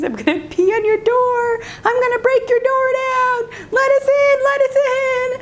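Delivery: very high, yelling imitation of small boys; rowdy little boys. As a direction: threaten through the door in a kid's squeal. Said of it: "you hear the boys".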